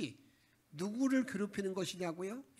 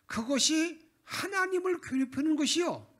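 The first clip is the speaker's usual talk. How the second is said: indignant prophet; 9 semitones higher, 7 dB louder than usual. Retold or imitated. Prophet rebukes Ahaz.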